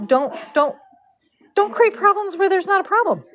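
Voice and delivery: high-pitched retold pleading; pleading admonishment. Braider stopping the owner from fussing, pleading. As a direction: stammer into a high, insistent plea. Retelling her plea to owner.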